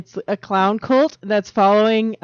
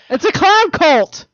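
excited; 8 semitones higher, 4 dB louder, slower than usual. Real, not acted. Spooky clown cult theory.

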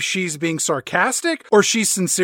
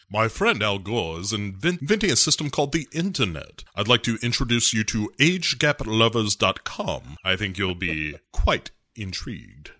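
low mock old Bond; 8 semitones lower, 4 dB quieter than usual.

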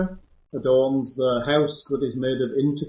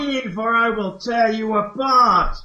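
threatening ghost; 7 semitones higher, slower than usual. Acted. GM voices ghost Agatha.

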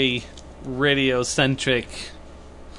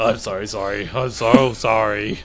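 mock apologetic voice; 4 semitones lower, slower than usual. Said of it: "I didn't actually have an accent".

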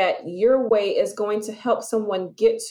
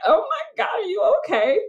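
much higher, strained near-shout of shocked concern; alarmed mock concern; 10 semitones higher than usual. Customer reacting to a rude clerk, shocked half-crying shout. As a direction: act stunned by how rude they were. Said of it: "You're like".

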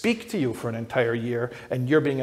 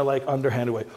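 ordinary talk, nothing marked

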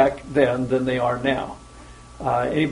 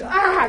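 high mock yelp of pain; imitated pained yelp. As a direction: yelp as if burned, playfully acted. Acts out CEO's burned yelp.

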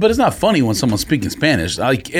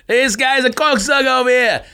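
put-on Boston accent; 12 semitones higher than usual. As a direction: do a Boston guy. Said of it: "Boston. When they call a cocksucker".

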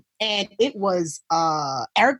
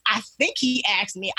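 ordinary talk

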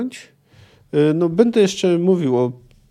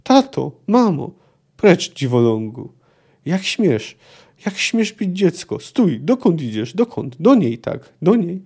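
reading a novel aloud, steady and measured; measured literary reading; story narration; 4 semitones higher, slower than usual. A narrator's reading. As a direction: tell it like an audiobook narrator. Child and parents in quarrel scene.

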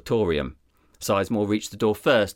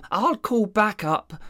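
much higher, dopey sidekick voice; dim deputy voice; 12 semitones higher than usual. Acted. Deputy rambling to his boss about coffee, dopey and high. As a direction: play the clueless deputy.

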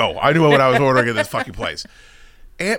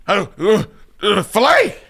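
mock panicked blurt; 6 semitones higher than usual.

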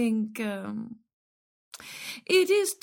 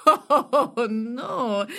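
laughing. Real, not acted. Laughs at being asked if rich.

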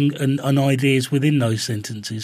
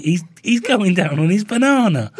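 excited mock outrage; 7 semitones higher, 3 dB louder than usual. Real, not acted. Mock commentary on banana eating.

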